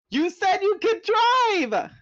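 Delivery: very high, loud, slow accusing shout. A narrator's reading. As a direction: hurl the accusation at the driver. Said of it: "Cassie accused".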